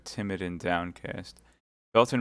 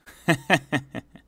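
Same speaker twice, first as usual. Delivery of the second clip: high, loud staccato laugh; acted laugh; 7 semitones higher, 6 dB louder than usual. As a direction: laugh out in separate bursts. Laugh acted inside the dramatic reading.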